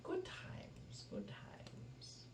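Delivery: breathy wistful whisper. Acted. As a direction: whisper it to yourself, nostalgic.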